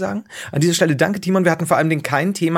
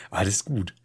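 ordinary talk, nothing marked

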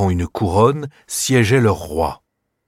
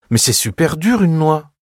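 put-on little girl voice; 8 semitones higher than usual. Clara objects to her uncle, childlike and high. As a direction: play a young girl protesting.

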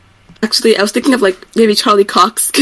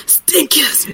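mock smoker's voice, pitched up; smoker's cough voice; 5 semitones higher, 4 dB quieter than usual. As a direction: say it like you have a smoker's cough. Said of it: "I'm suffering from smoker's cough".

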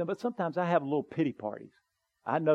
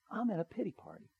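quiet admission; 5 semitones higher, 10 dB quieter, faster than usual. Retold or imitated. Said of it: "I didn't wanna say".

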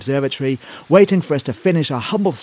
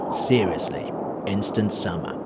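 conspiratorial; 7 semitones lower, 6 dB quieter, slower than usual. Acted. Conspiratorial ad pitch ending.

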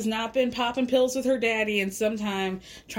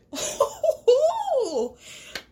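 real delighted laugh, much higher; high burst of laughter. Real, not acted. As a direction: crack up at the comeback. Amused at her comeback.

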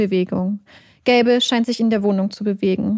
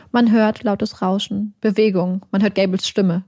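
usual speaking voice

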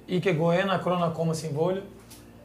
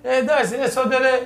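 higher-pitched hailing by nickname; imitated calling out; 6 semitones higher, 4 dB louder than usual. Player imitates someone hailing him by nickname, calling out. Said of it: "κάποιος με φωνάζει".

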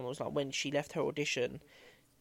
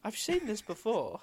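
slow, higher-pitched mock recognition; David Tennant; 6 semitones higher than usual. Friend finishes the joke about dressing like an earlier Doctor, slow mock recognition. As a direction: draw it out, pitched up, as a playful impersonation. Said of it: "looking like David Tennant and being like".

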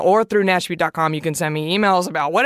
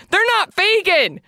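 higher, slow, disbelieving repeat; shocked; 10 semitones higher, slower than usual. Real, not acted. Telling vegan listeners about the probiotics, higher and slow in shock. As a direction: stretch it out in shock. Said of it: "I was shocked".

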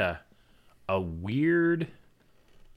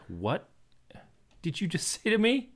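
put-on child's disbelief; 8 semitones higher, 3 dB quieter than usual. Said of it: "he looks at me like".

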